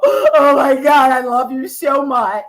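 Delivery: hammed-up tearful declaration, slow; mock fake sincerity; mock gushing. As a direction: overact the fake love like a performer. Mocking a fake emotional show.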